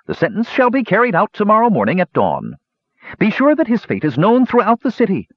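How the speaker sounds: high, ringing royal proclamation; queen proclaiming his hanging; proclaiming sentence. A narrator's reading. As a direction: announce the death sentence to the court. High Lord gives orders.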